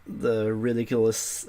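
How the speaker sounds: ordinary delivery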